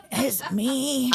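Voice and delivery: a hint of a creaky old man; lightly voiced old man. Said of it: "[name] doing his old man voice".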